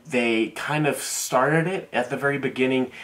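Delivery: usual speaking voice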